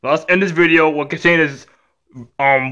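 ordinary talk

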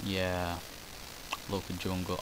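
low, soft droning monotone; a lightly done droning narrator, low and soft; drony voice. Retold or imitated. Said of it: "I've got a very drony voice".

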